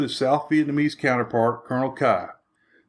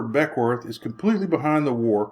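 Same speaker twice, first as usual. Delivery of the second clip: usual speaking voice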